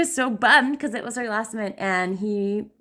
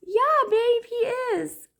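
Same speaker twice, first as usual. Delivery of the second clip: much higher, slow gentle coo; soothing mom; slower than usual. Retold or imitated. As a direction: humor her tenderly. Mom soothing sobbing daughter.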